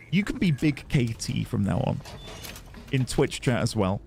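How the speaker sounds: usual speaking voice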